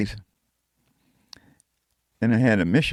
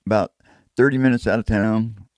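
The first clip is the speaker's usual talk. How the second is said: ordinary delivery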